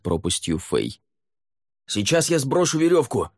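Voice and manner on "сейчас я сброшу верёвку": high, projected call; shout. A narrator's reading. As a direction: shout it down to the climber. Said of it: "крикнул Лаверн".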